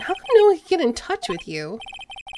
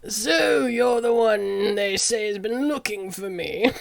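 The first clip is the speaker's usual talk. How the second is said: slow, lightly voiced old lawyer; a hint of a pompous old man; slow, self-important old attorney; 2 semitones lower, slower than usual. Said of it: "what should his voice be?".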